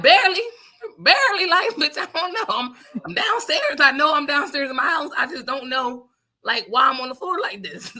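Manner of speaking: high, dazed voice that slows in the middle; barely awake and confused; groggy self. Retold or imitated. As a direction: hungover on the floor, answering her friend.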